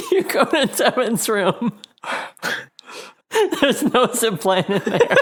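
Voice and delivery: high, laughing through narration; giggling as you tell it. Real, not acted.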